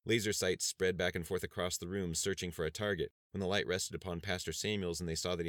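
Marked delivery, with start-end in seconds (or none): none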